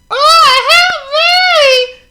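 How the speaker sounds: high loud panicked scream; cry for help. Acted. Mister Small calling as his rope snaps, high panicked cry. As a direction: yell as you fall.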